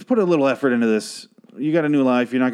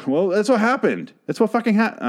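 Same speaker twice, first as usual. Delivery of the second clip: much higher, defiant joking insistence; mock insistence; 11 semitones higher than usual. Joking about the hijack scene, mock defiant. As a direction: insist it's fact, then back off as a joke. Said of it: "I'm just kidding".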